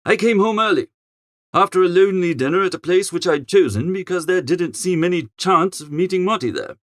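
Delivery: ordinary delivery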